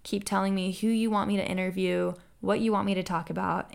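ordinary delivery

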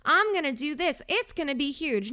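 mock your old wedding-obsessed self; gushing former self. Host mimics her younger self planning a wedding, mock gushing. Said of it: "I used to be like".

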